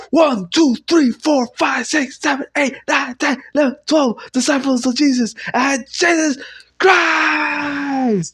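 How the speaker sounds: high counting that ends in a shout. Real, not acted. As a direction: yell the numbers, crying out the last word.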